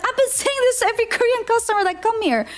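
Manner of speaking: high, fast retold alarm; hurried, high-pitched realization; mock dismay. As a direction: imitate a mortified chef in a rush. Retold chef's embarrassed realization.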